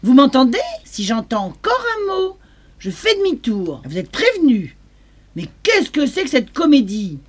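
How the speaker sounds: loud fast angry scolding; furious yelling mother. Acted. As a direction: yell at the kids in the back. Mother finally yelling at kids.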